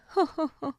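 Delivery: light polite laugh; a small courteous laugh; in-laws meeting, a polite little laugh. Acted.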